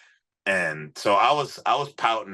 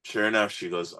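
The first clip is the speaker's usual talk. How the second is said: ordinary delivery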